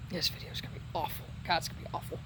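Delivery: half-whispered self-criticism; whispered aside. Real, not acted. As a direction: grumble it under your breath. Self-deprecating aside under breath.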